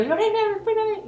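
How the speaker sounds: high, loud call after a friend. Retold or imitated. Calling to Lorena as she runs to another dune, loud and high. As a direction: shout after her to wait.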